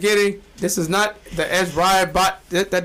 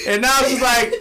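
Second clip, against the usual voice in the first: ordinary talk, nothing marked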